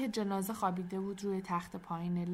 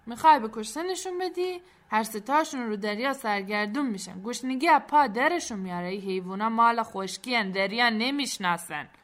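loud, higher-pitched scolding character voice; scolding sailor; 3 semitones higher, 9 dB louder than usual. Acted. Sailor warns boy the animals will die at sea, loud scolding. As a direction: warn them off with loud, emphatic force.

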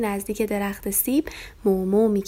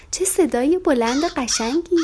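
higher, slow, delighted little lamb voice; 6 semitones higher, 4 dB louder, slower than usual. A narrator's reading. Lamb Farfari marvels at the crow's loud caw, higher and slower. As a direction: marvel at the noise like a small lamb.

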